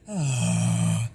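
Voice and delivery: a pushed, airy forced sigh; forced sigh demo. Shown as a demonstration. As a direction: sigh hard through the throat. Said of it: "what I just did with the".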